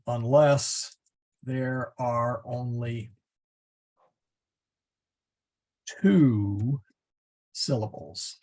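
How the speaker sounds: spaced out as if typing along; slow down word by word, pausing between phrases; measured, halting dictation. Real, not acted. Typing an exception while speaking.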